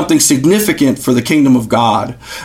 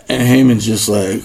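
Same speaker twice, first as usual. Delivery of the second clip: normal talking voice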